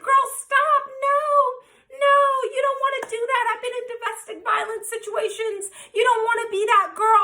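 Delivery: high-pitched mock plea; imitating pleading girlfriend. Imitating rival girl's plea.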